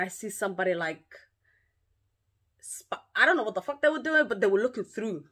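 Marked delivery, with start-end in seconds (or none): none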